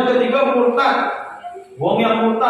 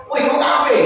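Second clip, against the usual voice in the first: higher-pitched call-out, curt challenge; confrontational intonation; 4 semitones higher, slower than usual. Shown as a demonstration. Preacher showing listeners the wrong intonation for addressing non-believers, confrontational. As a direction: call someone out, voice raised in pitch. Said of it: "cuma intonasinya beda".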